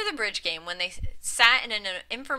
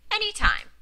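high answer; 13 semitones higher, 4 dB louder than usual. A narrator's reading. Bernice's quick high answer.

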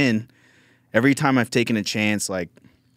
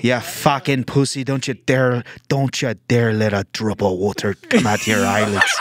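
higher, slow, furious scolding; mock angry inner voice; 4 semitones higher, 3 dB louder than usual. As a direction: bark it like a drill sergeant. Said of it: "that voice in your head".

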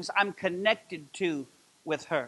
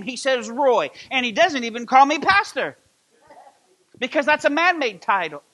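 louder, higher impassioned preaching; fervent preaching; 5 semitones higher, 6 dB louder than usual. Acted. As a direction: preach it out with full fervor. Preaching at peak.

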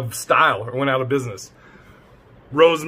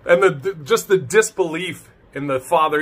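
usual speaking voice

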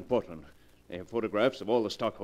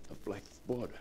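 hushed dread; 4 semitones lower, 12 dB quieter than usual. Acted.

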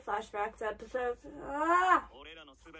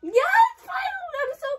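very high, elated outburst; excited relief; 9 dB louder, faster than usual. Real, not acted. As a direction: squeal it with long-awaited relief. Relieved reaction to long-awaited apology.

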